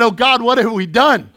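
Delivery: a loud, laughing cry to God, half joking; laughing mock prayer. New dad holding crying baby, laughing loud prayer. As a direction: ham up a panicked new dad's prayer, laughing. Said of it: "I prayed to God. I said".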